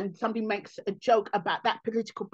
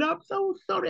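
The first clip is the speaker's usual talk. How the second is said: apologetic fan; 9 semitones higher than usual. Retold or imitated. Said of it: "people coming and going".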